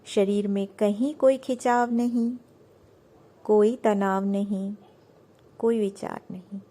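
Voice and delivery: measured, soothing meditation guide; unhurried yoga nidra instruction; guided meditation. Acted. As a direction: lead them into relaxation with long pauses between phrases.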